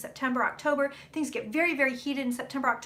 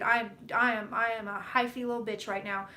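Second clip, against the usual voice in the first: usual speaking voice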